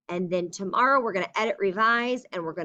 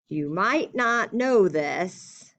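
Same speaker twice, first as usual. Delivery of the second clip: slow, drawn-out dictation; much slower than usual. Shown as a demonstration. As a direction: spell it out as you write. Teacher dictates letter aloud.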